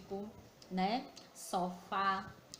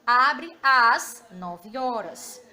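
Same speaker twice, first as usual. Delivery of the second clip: slow, stretched reading that leans on "às"; teacher slows and leans on "às" for the class; stress on às; 13 dB louder, much slower than usual. Shown as a demonstration. Teacher reading the sign to pupils, slow, leaning on "às". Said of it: "circule a vogal com acento grave".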